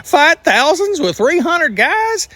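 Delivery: hammed-up incredulous plea; incredulous Gideon. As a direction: protest to God in high-pitched astonishment. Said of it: "Imagine Gideon's face".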